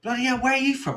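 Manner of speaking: high, mock-surprised Londoner. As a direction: lightly play a nosy Londoner teasing a Somerset girl. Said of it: "they start talking to them, like".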